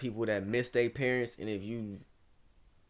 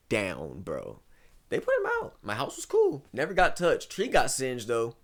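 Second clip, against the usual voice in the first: usual speaking voice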